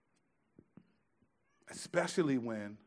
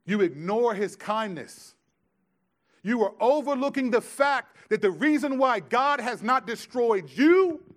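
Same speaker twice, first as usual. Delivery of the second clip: much louder and higher, slowing on destroyed you; preaching climax; 9 semitones higher, 11 dB louder than usual. Real, not acted. Preacher confronting the congregation, rising to a shout. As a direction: build to a shout over the church.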